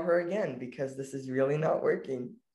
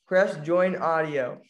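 slow, louder spelled-out instruction; slow and deliberate; 4 dB louder, slower than usual. Real, not acted. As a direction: say it slowly so she gets it. Repeats instruction slowly.

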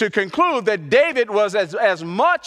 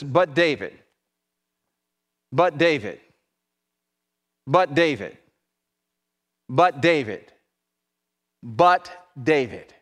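slow, low, weighty BUT David; emphatic repetition; 7 semitones lower, 4 dB louder than usual. Acted. Preacher to the congregation, dwelling on BUT David, low and heavy. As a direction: lean hard on "But" in "But David".

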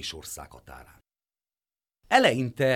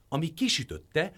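usual speaking voice

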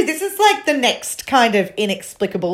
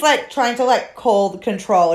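ordinary talk, nothing marked

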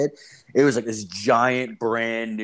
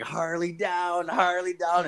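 imitated yell; 7 semitones higher, slower than usual. Biker-game player calling the owner out, imitated yell. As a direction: call it out high and stretched, like a held-back yell. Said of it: "I yelled, I yelled Harley down".

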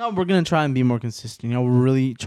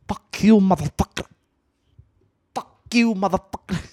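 higher, punchy hammed-up movie insult, said twice; quoting movie scene; 7 semitones higher, 5 dB louder than usual. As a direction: relish the film line like a fan. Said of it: "I love that fucking scene".

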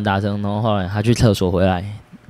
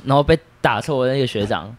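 usual speaking voice

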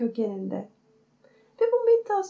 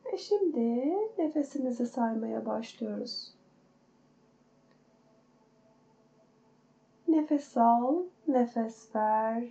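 guided meditation. Acted. Counts breaths in meditation.

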